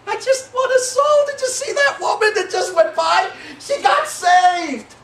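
high-pitched, bursting with acted excitement; excited. Jesus telling disciples the woman got saved, elated. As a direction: exclaim it high, as thrilling news. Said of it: "He was excited".

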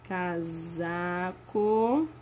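slow, drawn-out dictation pace; spelling out words for children to write. Shown as a demonstration. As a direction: stretch each word out for writing. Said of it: "Agora vamos escrever as palavras embaixo".

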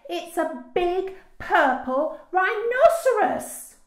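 high, loud, drawn-out shout. A narrator's reading. Daisy corrects Dad about the spider, shouting. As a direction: shout it slowly, word by word. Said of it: "Daisy shouted".

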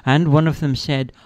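plain reading voice

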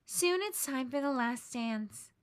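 ordinary delivery, nothing marked

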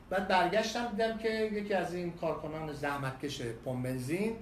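ordinary talk

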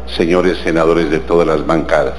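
usual speaking voice

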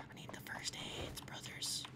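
unvoiced whisper. Real, not acted. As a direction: breathe it out in a whisper. Whispered aside while looting.

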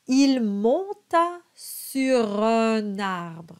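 slow, careful French: monta with a nasal O; slow French model. Shown as a demonstration. Coach reads the verse slowly for students. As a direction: sound out each French syllable. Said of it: "the nasalized O sound".

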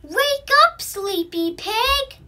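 high, loud wake-up call; rooster calling. A narrator's reading. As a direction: shout it across the farmyard. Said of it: "Rooster called out".

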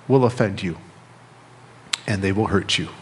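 ordinary delivery

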